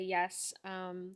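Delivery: normal talking voice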